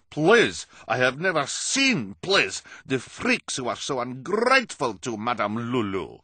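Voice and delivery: high, loud put-on foreign accent, bossy; Madame Lulu's accent. Carnival boss berating the freaks in a put-on accent. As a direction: scold them in a thick fake accent. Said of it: "a woman with an odd accent and a bad temper".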